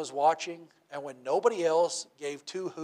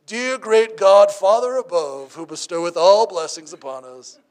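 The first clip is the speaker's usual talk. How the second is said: pompous fake prayer; 3 semitones higher, 13 dB louder than usual. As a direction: ham up a showy holy prayer. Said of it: "why don't you pray?".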